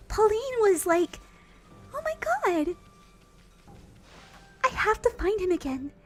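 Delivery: very high Disney princess voice; Pauline. Shown as a demonstration. Streamer showing viewers her princess character, high Disney princess. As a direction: lift it way up like an animated princess. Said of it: "She's very Disney princess".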